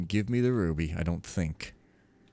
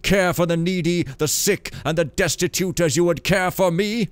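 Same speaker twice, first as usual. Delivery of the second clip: loud, declaiming divine rebuke; voice like thunder; 8 semitones higher, 6 dB louder than usual. Acted. Builder quoting scripture to the novice, thundering voice. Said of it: "in a voice like thunder".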